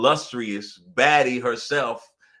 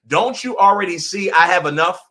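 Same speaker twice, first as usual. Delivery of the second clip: higher and louder, fed-up disbelief; exasperated outburst; 6 semitones higher, 7 dB louder than usual. Retold or imitated. To an oblivious friend, higher and louder exasperation. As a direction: throw it back at a friend who won't notice your bad day.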